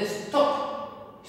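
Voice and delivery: one strong, lengthened beat on stop; stress on stop. Shown as a demonstration. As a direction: put the full force of the voice on stop. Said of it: "la mayor fuerza de voz tiene que estar acá".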